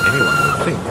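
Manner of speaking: chuckling. A narrator's reading. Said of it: "chuckled Toby".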